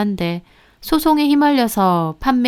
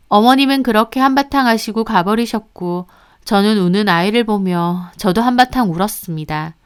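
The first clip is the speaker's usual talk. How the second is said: ordinary delivery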